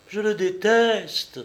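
plus aigu et lent, hors d'elle; cri de colère. A narrator's reading. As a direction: cracher la haine pour son mari. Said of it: "s'écria Julie hors d'elle-même". (Julie, hors d'elle, crie contre son mari)

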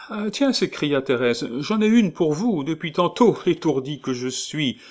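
high, loud motherly landlady voice; kindly landlady. Acted. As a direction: chirp it like a bustling landlady.

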